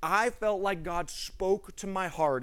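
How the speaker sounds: normal talking voice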